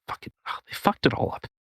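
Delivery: whispered aside; under the breath. Real, not acted. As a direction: drop to a whisper for the curse. Muttered aside about libertarians.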